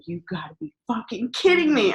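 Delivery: high, angry disbelief; wife finally reaches her husband, angry disbelief; going off. Retold or imitated. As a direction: snap at him like you've had enough. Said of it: "And I went off".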